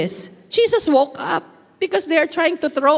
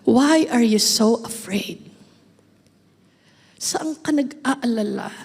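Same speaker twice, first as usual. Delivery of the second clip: tender question; 3 semitones lower than usual. Acted. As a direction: gently ask why they're afraid. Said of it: "God is asking you right now". (malumanay at mabagal na tanong)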